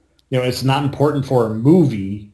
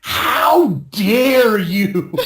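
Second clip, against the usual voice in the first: slow, hammed-up wounded cry; drawn-out mock indignant shout; mock outrage; 8 semitones higher, much slower than usual. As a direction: overplay the offense, dragging it out. Mock outrage at friend's jab.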